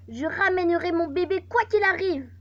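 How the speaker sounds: loud, high-pitched vow; defiant ex-wife. Acted. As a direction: act an ex-wife swearing she will win. Acted ex-wife's vow in game dialogue.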